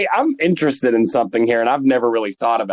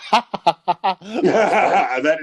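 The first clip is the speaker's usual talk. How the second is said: laughing; 4 semitones higher than usual. Real, not acted. Laughing at own joke.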